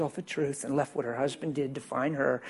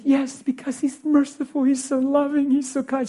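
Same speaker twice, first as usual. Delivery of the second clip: much higher and louder, gushing imitation; rapturous praise; 11 semitones higher, 6 dB louder than usual. Woman praising God to her pastor, overjoyed. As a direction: sing out her praise in delight.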